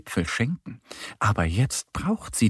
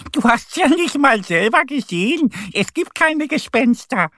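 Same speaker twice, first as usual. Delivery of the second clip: high, loud, dismissive duck voice; amused little duck; 12 semitones higher, 4 dB louder than usual. A narrator's reading. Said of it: "Annie lacht". (hohe, laute, belustigte Entenstimme)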